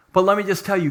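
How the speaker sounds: ordinary delivery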